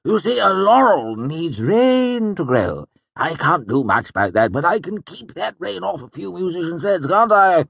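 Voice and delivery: high excited nobleman; bright, laughing older count; Count Threep voice. A narrator's reading.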